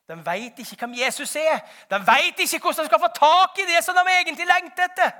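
shouted plea, building to full force; high, loud, urgent sermon climax; impassioned preaching. Real, not acted. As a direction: cry it out on behalf of the lost.

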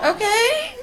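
awkward stunned reply, last word jumping up high and loud; flustered squeak. Retold or imitated. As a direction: caught off guard, squeak out the okay. Flustered reply to stranger's invitation.

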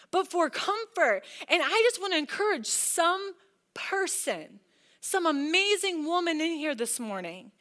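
ordinary talk, nothing marked

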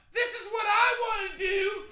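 high shrieking yell. Retold or imitated. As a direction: scream it like a furious teen. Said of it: "he just yelled".

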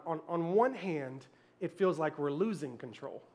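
low, soft, sincere sermon close; hushed preaching. Real, not acted. Preacher tells church about surrender, hushed and sincere. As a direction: bring it right down, gently earnest, with long pauses.

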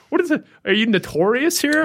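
a friend half-joking in disbelief; amazed buddy. Retold or imitated. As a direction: friend asking if he is infamous, mock astonished. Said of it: "He goes".

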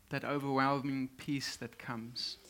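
ordinary talk

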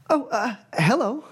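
put-on high, polite young woman; a nervous, eager student caught off guard; satyr student. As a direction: greet them timidly from her chair.

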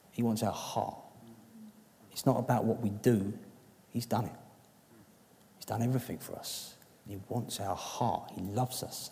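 a soft, tender aside to the church. Real, not acted. Preacher drops to a soft voice telling the church God loves them. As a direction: let it land gently, just above a hush.

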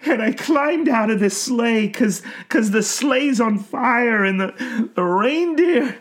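high, strained anguish; anguished old man. A narrator's reading. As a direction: confess through anguish. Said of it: "words came out on puffs of anguished".

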